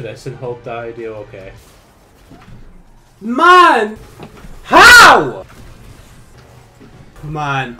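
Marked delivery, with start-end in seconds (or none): 3.2-5.5 much higher, much louder disbelieving yell; raging outburst. Real, not acted. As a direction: scream the disbelief at the screen. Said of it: "I could feel the rage burning in me".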